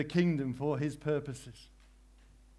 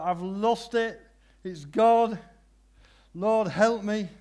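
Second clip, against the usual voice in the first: high, loud desperate plea; crying out to God; 5 semitones higher, 6 dB louder, slower than usual. Retold or imitated. Man crying to God over the lost axe head, loud. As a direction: call out for help. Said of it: "He cries out".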